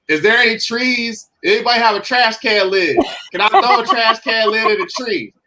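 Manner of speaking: high goofy joking voice; joking around. Retold or imitated. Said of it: "I started joking around".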